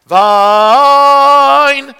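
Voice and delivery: held loud cutoff. Shown as a demonstration. Song leader showing cutoff to class, loud held note. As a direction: belt the final "Vine". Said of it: "you can cut off".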